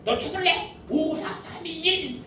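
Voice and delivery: high, threatening count down from five; angry parent countdown. Retold or imitated. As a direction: count down at the child like an exasperated parent. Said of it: "다 해보지 않았어요?".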